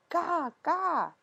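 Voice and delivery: put-on high fussy baby babble; crying baby babble. A narrator's reading. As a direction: whine it like a fretful baby. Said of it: "He cried and cried".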